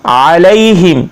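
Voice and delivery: slow word-by-word tajweed; slow Arabic recitation for learners; Quranic recitation lesson. Shown as a demonstration. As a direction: draw out the long vowels, pause after each phrase. Models the verse.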